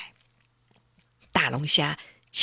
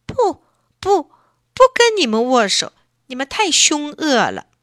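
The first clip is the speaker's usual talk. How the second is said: high, tearful and loud angry no; huffy refusal; 6 semitones higher, 4 dB louder than usual. A narrator's reading. Elsie angrily refusing the lobster's handshake. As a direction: refuse in a huff, near tears. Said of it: "气呼呼地说".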